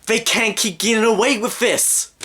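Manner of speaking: high, anguished protest; Jesse Pinkman. Retold or imitated. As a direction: wail it in exasperation. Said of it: "To quote Jesse Pinkman in Breaking Bad".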